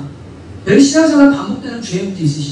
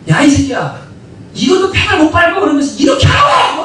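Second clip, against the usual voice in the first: imitated exasperated dad, raised voice; angry father; 4 semitones higher than usual. Father teaching his child to ride a bike, angry scolding. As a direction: act out a father losing his temper, building to a shout. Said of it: "막 성질 내고 막 화를 내면서".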